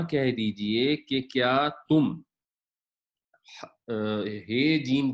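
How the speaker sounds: ordinary talk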